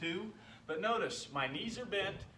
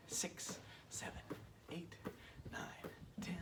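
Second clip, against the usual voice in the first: counting under breath; 4 semitones higher, 12 dB quieter, slower than usual. Real, not acted.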